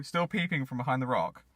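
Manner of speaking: ordinary delivery, nothing marked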